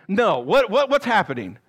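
ordinary talk, nothing marked

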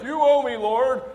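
high, loud entitled demand; mock demanding. Preacher parodying someone claiming rights from God, mock demand. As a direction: hammer it out in exaggerated protest.

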